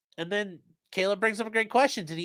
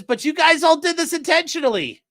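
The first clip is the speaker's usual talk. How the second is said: higher, louder mock whine of the defendant; mimicking Copeland; 9 semitones higher, 5 dB louder than usual. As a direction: toss the accusation up high like an aggrieved witness. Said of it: "Then Copeland says".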